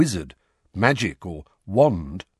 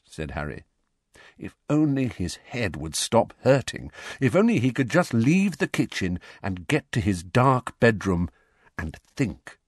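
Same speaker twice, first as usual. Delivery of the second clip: ordinary delivery, nothing marked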